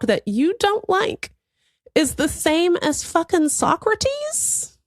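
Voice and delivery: high, slow disbelieving mockery; incredulous mockery. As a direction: scoff at him in disbelief. Mocking retort to critic.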